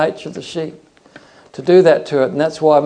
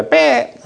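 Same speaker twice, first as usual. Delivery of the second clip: imitated lamb bleat; 12 semitones higher, 3 dB louder than usual. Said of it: "this thing would go".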